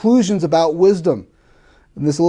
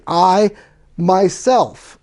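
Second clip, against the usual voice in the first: "I... myself", higher, each word set apart by a pause; emphatic; 4 semitones higher, 3 dB louder, much slower than usual. Retold or imitated. Preacher showing how Solomon insists on himself, emphatic "I... myself". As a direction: land separately and firmly on "I" and then "myself". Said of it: "what Solomon's doing is very emphatic".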